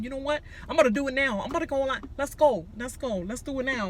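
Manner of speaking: mock Nick losing it; snapping. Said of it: "he just snaps".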